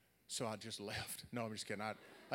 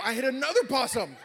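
very high, very loud excited yell; full-volume comic exclamation; climactic shout; 12 semitones higher, 20 dB louder than usual. Real, not acted. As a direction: shout the punchline over the laughter.

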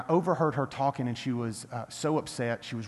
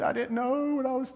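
imitated crying; 12 semitones higher than usual. Hard lady breaks down over the gift, imitated crying. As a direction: imitate someone breaking down, voice pitched way up. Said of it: "you know, she's crying".

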